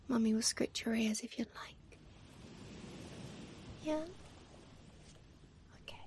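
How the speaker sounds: gentle whispered ASMR talk to a pet; hushed whisper, as if not to startle a sleepy kitty; soft whisper. Real, not acted. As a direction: whisper soothingly to the cat. Whispering to her cat.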